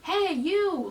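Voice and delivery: high mock yell; imitated yelling. Guard hailing a coworker whose name he doesn't know, mock yelling. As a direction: imitate hollering at a stranger. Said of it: "like, yelling in the stadium".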